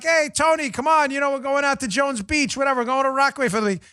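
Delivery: put-on high-pitched New York kid; hammed-up Bronx street talk; Bronx accent. Bronx kid rallying Tony to the beach, high New York accent. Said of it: "I grew up in the Bronx".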